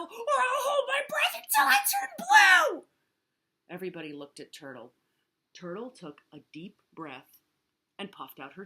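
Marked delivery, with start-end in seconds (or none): 0.0-2.9 shrieked threat, very high and loud; screaming. A narrator's reading. Turtle threatens to hold breath, screaming. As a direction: scream through to a long last word. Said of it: "screamed Turtle".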